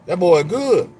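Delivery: loud, high punchline delivery; loud callback to Coming to America; loud movie catchphrase. Retold or imitated. Quoting movie line again.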